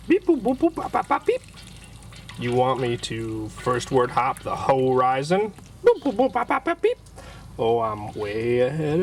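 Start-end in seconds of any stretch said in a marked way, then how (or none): 0.1-1.4 high robotic beeping; robot beeps. Acted. App answering as robot. 5.8-7.0 high robotic beeping; robot beeps. Acted. App answering as robot.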